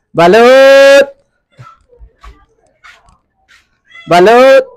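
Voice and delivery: shouting balot twice down the street; loud hawker's cry; vendor's call. Real, not acted. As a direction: call out to buyers. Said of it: "sa pagsigaw ko".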